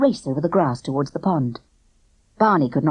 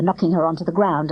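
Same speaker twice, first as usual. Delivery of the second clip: ordinary delivery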